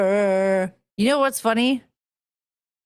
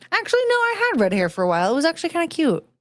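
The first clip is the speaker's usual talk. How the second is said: high and fast, bubbly; excited; 7 semitones higher, much faster than usual. Real, not acted. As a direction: rattle it off brightly, much higher. Excited self-correction.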